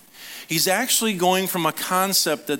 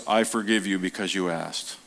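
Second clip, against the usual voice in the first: mock mechanical Jesus; 7 semitones lower than usual. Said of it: "And he goes".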